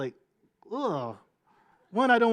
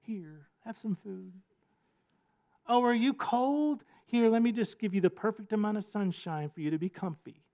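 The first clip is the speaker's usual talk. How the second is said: softer and softer, a hammed-up gentle coo; mock-kind Mother Nature; 5 dB quieter than usual. Pastor voicing a kind Mother Nature, mock soft and gentle. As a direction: play Nature as a sweet, caring host. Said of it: "Mother Nature said".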